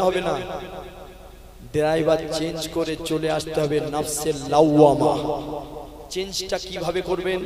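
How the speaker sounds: rhythmic waz sermon delivery; a Bengali preacher calling out to his listeners; preaching. Acted. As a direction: preach to the gathering, line by line.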